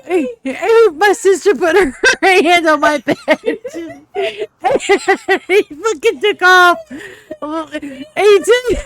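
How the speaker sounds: giggling through the story; laughing while telling it; laughing hard. Real, not acted. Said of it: "I am laughing so hard I'm crying".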